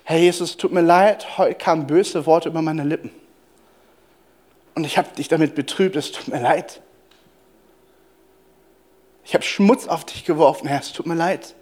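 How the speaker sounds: gentle, sorry prayer spoken under the breath; quiet contrite prayer. Shown as a demonstration. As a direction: pray it softly, like a sincere apology. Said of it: "es auch wirklich mal so zu beten".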